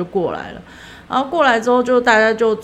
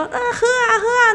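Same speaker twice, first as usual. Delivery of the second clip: high whining cheer; hammed-up drinking call; rowdy cheer. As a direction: egg them on to drink. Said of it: "他就开始就说".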